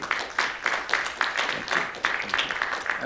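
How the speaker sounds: breathy whispered thank you, barely voiced. Real, not acted. Musician whispering thanks to his audience, hushed. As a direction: whisper it gratefully to the crowd.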